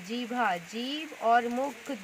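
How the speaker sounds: जीभ and मुख said slowly; slow, emphatic. Shown as a demonstration. Teacher to pupils, stressing tongue and mouth slowly. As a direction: spell out the key words. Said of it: "क्या करना पड़े".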